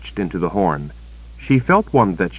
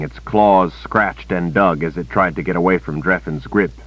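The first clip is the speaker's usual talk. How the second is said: usual speaking voice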